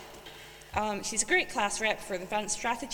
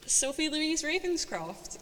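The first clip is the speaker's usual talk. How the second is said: slow, high, drawn-out winner reveal; suspenseful awards presenter; 5 semitones higher, slower than usual. Real, not acted. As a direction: draw out the name like a big reveal. Announcing the winner.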